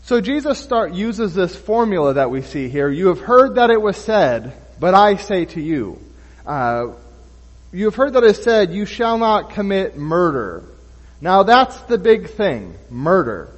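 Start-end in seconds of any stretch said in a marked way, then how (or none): 2.9-6.0 louder, proclaiming the formula; preaching emphasis. Acted. Preacher declaring Jesus' formula to the church, loud and proclaiming. 7.7-10.6 louder, faster, higher proclamation; preaching emphasis. Acted. Preacher proclaiming the commandment to his listeners, loud and driving.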